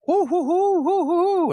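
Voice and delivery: high hooting owl call, held back; barred owl hoot. Shown as a demonstration. Showing the host how to call owls, high owl hoots. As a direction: hoot like a barred owl calling out, not full volume. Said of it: "if you wanna call owls in that".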